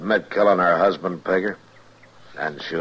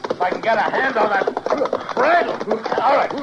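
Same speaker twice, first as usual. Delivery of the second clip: soothing a horse; 12 semitones higher than usual. Acted. Calming a runaway horse.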